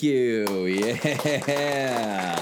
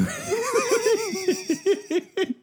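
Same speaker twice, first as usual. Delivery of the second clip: shrieking giggle fit; high squealing laugh. Real, not acted. High laughing after take.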